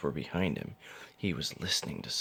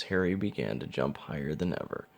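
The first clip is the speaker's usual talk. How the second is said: ordinary delivery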